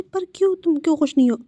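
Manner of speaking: baffled that he isn't happy; puzzled surprise. Acted. Friend puzzled at sadness.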